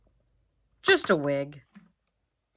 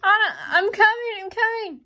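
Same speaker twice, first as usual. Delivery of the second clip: calling out; 8 semitones higher, 3 dB louder than usual. Pretend. Hero calls out to Anna.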